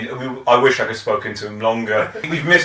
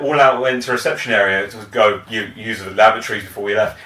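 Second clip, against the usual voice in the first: normal talking voice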